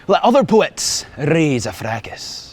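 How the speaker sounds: slow, grand reading of a Scots poem; poem recitation. Acted. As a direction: recite it like a proud bard, slowly. Guide recites Burns poem.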